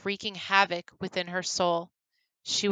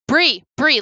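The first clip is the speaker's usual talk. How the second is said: urgent call; 6 semitones higher, 10 dB louder than usual. Acted. Grum calls to Bree.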